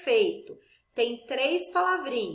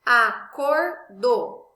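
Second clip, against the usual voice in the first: Acordo bem devagar, sílaba por sílaba, mais alto; palavra silabada; 6 dB louder, much slower than usual. Shown as a demonstration. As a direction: estique Acordo pausando entre as sílabas. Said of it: "o R e o T se separaram". (professora silabando Acordo devagar)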